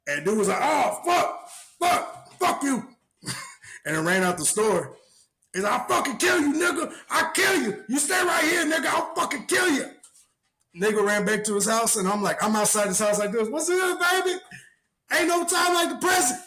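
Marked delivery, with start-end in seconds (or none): 0.6-2.8 high pained outburst; pained cursing. Retold or imitated. Punched dude cursing in high-pitched pain. 5.7-9.9 high, shouting mock threats; screamed threat. Dude threatening after hit. 13.5-16.4 pitched-up mocking taunt; high sing-song taunt. Outside his house, taunting the dude in a high voice.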